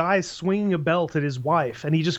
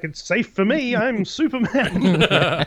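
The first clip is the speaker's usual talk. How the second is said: higher, playful hero boast, slowing at the end; Superman; 4 semitones higher, slower than usual. Retold or imitated. Superman brags to trapped mine owner, playfully smug. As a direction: draw out the last words, laughing. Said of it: "Superman's like".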